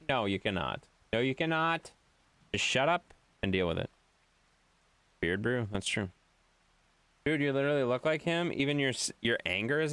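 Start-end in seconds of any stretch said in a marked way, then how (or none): none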